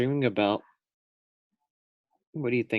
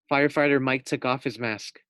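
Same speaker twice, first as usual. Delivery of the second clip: plain reading voice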